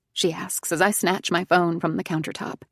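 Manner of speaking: plain reading voice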